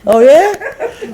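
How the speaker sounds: high, rising knowing oh yeah; mock husbands agreeing. Husbands reacting to wives' complaints, high sarcastic oh yeah. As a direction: sneer it sarcastically. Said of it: "many husbands like".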